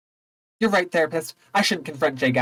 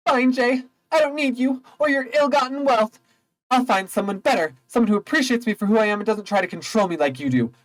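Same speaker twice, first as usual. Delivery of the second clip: high, indignant dismissal; huffy Daisy; 9 semitones higher than usual. Acted. Daisy dumping Gatsby in a high, indignant voice. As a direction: toss him off in a lifted huff.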